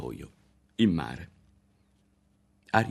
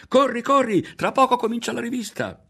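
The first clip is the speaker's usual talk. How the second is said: very high, loud calling out; urgent shout; 8 dB louder than usual. A narrator's reading. Berto yells for Ario to run to the parade. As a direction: yell for him to hurry. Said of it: "gridò Berto".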